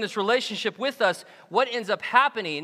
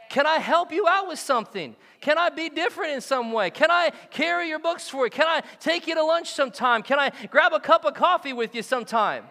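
eager offers; 6 semitones higher than usual. Retold or imitated. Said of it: "offering help".

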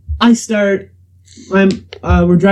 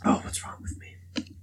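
whisper; 20 dB quieter than usual. Real, not acted. Whispered aside.

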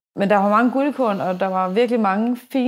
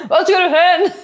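laughing; 9 semitones higher, 6 dB louder than usual. Retold or imitated. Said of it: "Sådan lidt".

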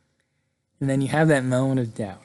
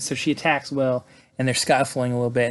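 usual speaking voice

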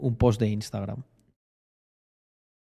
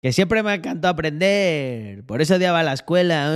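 different silly voice; 3 semitones higher than usual. Pretend. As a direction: drag it out in a put-on voice. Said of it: "Puedo poner diferentes, diferentes voces".

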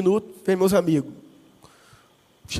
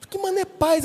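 baffled friend; 11 semitones higher than usual. Retold or imitated.

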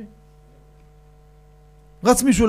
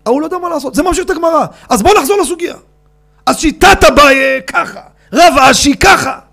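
high, loud lecturing sing-song; resume the lesson in full voice; lecturing rabbi; 8 semitones higher, 10 dB louder than usual. Retold or imitated. Father going back to the Gemara, loud lecturing.

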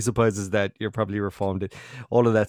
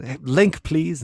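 mock shout; 7 semitones higher, 4 dB louder, much slower than usual. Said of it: "until someone shouts at me".

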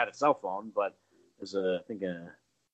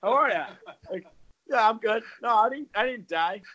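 high casual mock-cheery; 12 semitones higher than usual. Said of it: "hanging in the parking lot".

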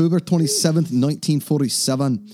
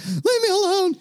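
squeaky plea. Retold or imitated. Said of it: "And I was like".

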